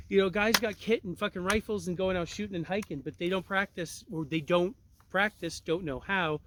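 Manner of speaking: ordinary talk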